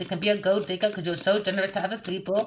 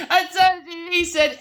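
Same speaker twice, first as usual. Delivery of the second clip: laughing taunt; 10 semitones higher, 5 dB louder than usual. Real, not acted. Cackling taunt.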